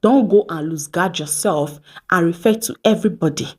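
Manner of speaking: normal talking voice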